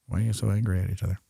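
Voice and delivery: quick, soft aside; muttered repeat question. Acted. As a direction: mutter it rapidly.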